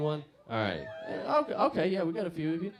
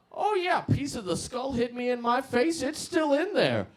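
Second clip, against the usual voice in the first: put-on high-pitched, loud rambling old man; high old drunk; 12 semitones higher, 5 dB louder than usual. Old drunk tells the young man about the skull, high rambling. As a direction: boast like a tipsy old geezer.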